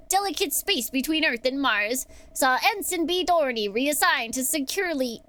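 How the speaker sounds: usual speaking voice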